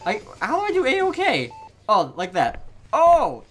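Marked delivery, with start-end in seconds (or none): 0.1-1.5 flustered. Real, not acted. Flustered repeating his question. 2.9-3.4 sudden high, loud "Oh!"; startled shout. Real, not acted. Sudden creepy nurse appears.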